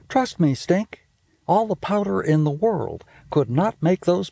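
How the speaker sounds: high, quick bossy big-sister voice; a know-it-all sister teasing her brother; Judy's voice. Acted. Big sister scolding little brother about smell, high and bossy. Judy speaking in story.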